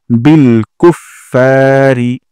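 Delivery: "কুফফারি" with ফা held one alif long; slow, stretch the ফা; lengthened fa. Shown as a demonstration. Teacher showing the long ফা. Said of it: "ফাতে এক আলিফ টান দিব".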